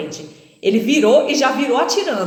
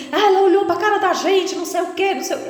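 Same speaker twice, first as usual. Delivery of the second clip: angry robber; 9 semitones higher, faster than usual. Retold or imitated. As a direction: accuse her of looking. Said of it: "ele falava". (bandido acusando, agudo e alto)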